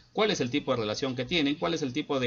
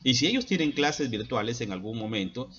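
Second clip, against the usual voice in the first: ordinary delivery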